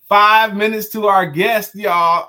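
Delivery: loud, slow hype call; excited announcement. Real, not acted. Counting down the guest to viewers, loud excited call. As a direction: hype up the audience.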